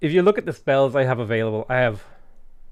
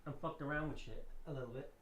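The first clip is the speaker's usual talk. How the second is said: quiet; 4 semitones higher, 18 dB quieter than usual. Real, not acted. Explaining nephew messed with computer.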